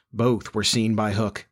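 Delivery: ordinary delivery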